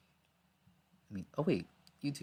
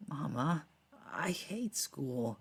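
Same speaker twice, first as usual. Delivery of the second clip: slow, far-off whiny voice; distant flashback echo; 7 semitones higher, much slower than usual. Acted. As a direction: drawl it out like an old memory. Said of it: "It's like a flashback voice".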